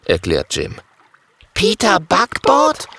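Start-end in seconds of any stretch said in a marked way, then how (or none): 1.5-2.9 surprised call. Acted. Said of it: "rufen Kokosnuss und Mathilda".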